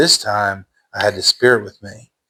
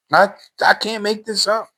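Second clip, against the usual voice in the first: normal talking voice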